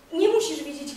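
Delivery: usual speaking voice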